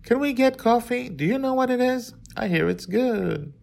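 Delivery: a fussy princess milking her ordeal; dramatic princess. A narrator's reading. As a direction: princess telling the father about her ordeal, high and dramatic. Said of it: "I heard her say".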